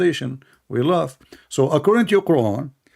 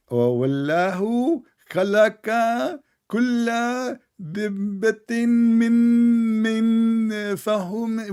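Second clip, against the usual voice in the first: mocking halting reader; 6 semitones higher, faster than usual. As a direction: mimic someone painfully sounding out each word. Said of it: "he's trying to make the sound".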